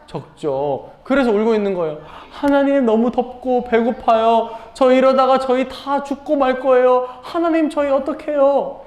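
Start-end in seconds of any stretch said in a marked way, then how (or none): 2.3-8.8 high-pitched mock-crying plea; tearful prayer. Hungry Israelites begging God in the desert, acted tearful high plea. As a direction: act out a desperate wail without real sobs. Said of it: "이렇게 울면서 기도했어요".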